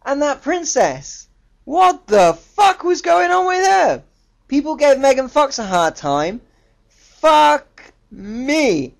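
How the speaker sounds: high, loud outraged disbelief; exasperated rant. Real, not acted. Film reviewer ranting about the princess actress, high loud exasperation. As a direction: let it rip, high and incredulous.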